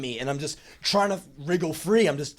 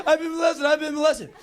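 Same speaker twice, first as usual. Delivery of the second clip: high, chirpy boasting; proud kid; 13 semitones higher, 4 dB louder than usual. Retold or imitated. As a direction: show off a new word like a present. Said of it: "I just start repeating it".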